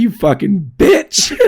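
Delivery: squeaky cracking yell. Acted. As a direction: voice cracking high on the insult. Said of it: "That doesn't sound good with the voice crack".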